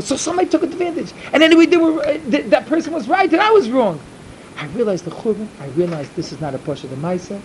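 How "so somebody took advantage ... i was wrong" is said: high, loud burst of agitation; worked-up outburst, pitch jumping up; agitated self-reproach. Acted. Man berating himself over his mistake, agitated. Voices his own anguished realisation.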